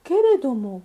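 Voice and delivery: usual speaking voice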